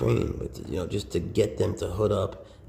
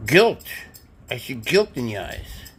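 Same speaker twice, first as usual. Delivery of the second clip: louder, higher playful accusing; mock accusation; 5 semitones higher, 11 dB louder than usual. Owner teases her snake about guilt, hammed-up. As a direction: point out the guilt with mock drama.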